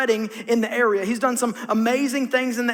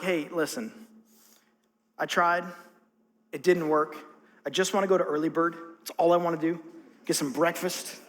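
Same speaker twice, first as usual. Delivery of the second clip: lower, weary grumbling fisherman; worn-out Peter; 5 semitones lower than usual. Retold or imitated. Peter tells Jesus he just wants breakfast, weary. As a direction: sound done with the night. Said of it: "if I'm Peter, I'm like".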